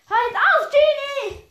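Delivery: high, loud outburst; angry shout. Acted. Patience snapping at Genie, high loud shout. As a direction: yell at them to stop.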